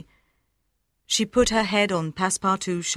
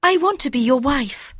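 much higher, gentle female voice; tender young woman; 5 semitones higher than usual. A narrator's reading. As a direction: Aouda reassuring Fogg. Aouda voice.

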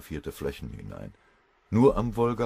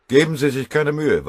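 loud, high cold cut-in; cold interjection; 11 semitones higher, 12 dB louder than usual. Acted. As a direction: cut him off coldly and loudly. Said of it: "warf Nikita Sergejewitsch kalt ein".

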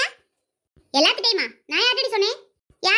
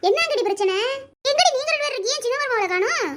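usual speaking voice

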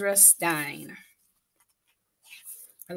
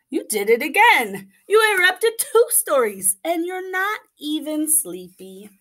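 papa exasperated; 8 semitones higher, 4 dB louder than usual. Acted.

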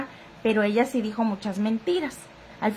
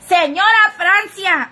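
higher, louder, slow call of the name; 8 semitones higher, 8 dB louder, slower than usual. Real, not acted. Streamer calling out to a commenter, loud slow hail. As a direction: call her name loudly across the room.

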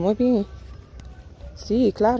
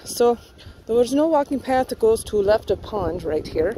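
normal talking voice

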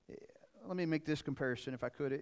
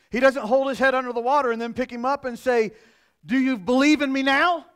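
mock harsh taunt; 10 semitones higher, 14 dB louder than usual. Mocking harsh Jesus voice.

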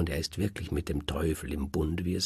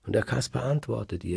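ordinary delivery

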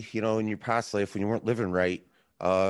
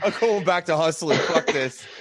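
laughing mock quit; 4 semitones higher, 5 dB louder than usual. Joking about taxes on paycheck.

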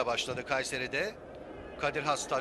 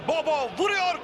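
sudden burst, loud and high; excited shot call; 8 semitones higher, 4 dB louder than usual. Real, not acted. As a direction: jump up on the shot. Shot called live.

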